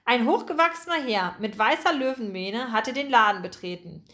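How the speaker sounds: plain reading voice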